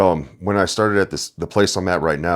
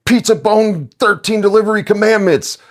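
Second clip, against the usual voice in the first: much higher and louder, hammed-up scold; mock scolding; 6 dB louder than usual. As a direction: call them out like a stern referee. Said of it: "we can be like".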